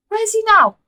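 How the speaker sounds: high, loud sharp demand. Acted. Mother demanding where the boyfriend is, sharp and shrill. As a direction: demand an answer.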